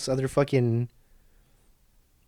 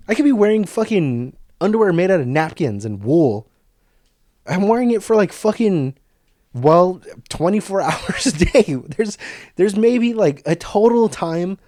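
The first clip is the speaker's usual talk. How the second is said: louder, heated ranting; worked-up rant; 5 semitones higher, 4 dB louder than usual. Real, not acted. Vlogger ranting about comfy underwear claims: worked-up, louder. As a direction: get fired up about it.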